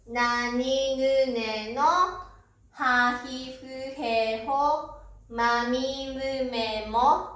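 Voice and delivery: drawn-out syllables, row by row; measured classroom chanting with long vowels; slow kana recital. Shown as a demonstration. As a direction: recite the kana chart slowly and evenly. Teacher recites the kana chart.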